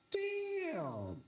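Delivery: high, drawn-out amazed damn; retold amazement. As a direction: start way up high, slide down in awe. Retelling his amazed reaction.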